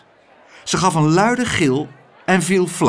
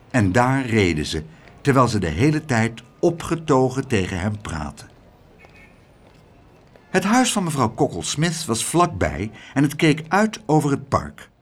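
ordinary delivery

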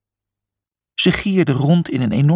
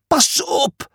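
high squeaky warning; squeaking; 12 semitones higher than usual. A narrator's reading. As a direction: squeak it out in alarm. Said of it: "piepte Julius".

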